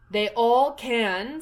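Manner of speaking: lightly voiced curt Duchess; haughty Duchess. A narrator's reading. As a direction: talk down to the girl. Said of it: "said the Duchess".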